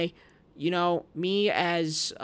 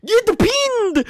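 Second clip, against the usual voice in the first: much higher and louder, drawn out; excited shout; 13 dB louder, slower than usual. Real, not acted. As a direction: yell it in triumph.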